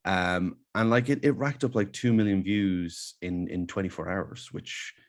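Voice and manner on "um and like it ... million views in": ordinary talk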